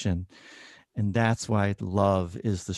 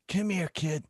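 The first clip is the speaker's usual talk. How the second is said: put-on much higher old woman voice; imitating a blunt old patient; old lady scolding; 9 semitones higher, 4 dB quieter than usual. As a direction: call out the doctor. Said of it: "patient beckons".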